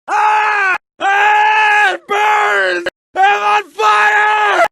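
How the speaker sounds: high loud agonized screams, slowing; screaming in pain. Acted. Burning man screams in agony. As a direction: howl as the flames catch.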